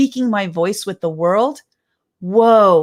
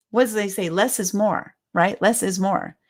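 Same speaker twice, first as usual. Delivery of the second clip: usual speaking voice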